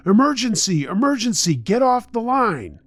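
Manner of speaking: high-pitched, frantic demand; urgent yelling. A narrator's reading. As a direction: yell to clear the phone line in an emergency.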